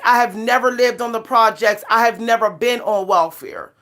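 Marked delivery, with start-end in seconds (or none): none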